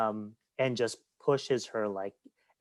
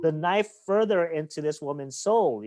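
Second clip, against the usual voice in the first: much higher, indignant cry at the villain; outraged disbelief; 7 semitones higher, 4 dB louder than usual. Retold or imitated. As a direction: squeal it out in shock at the cruelty. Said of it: "I'm like".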